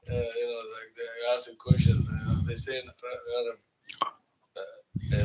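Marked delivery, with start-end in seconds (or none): none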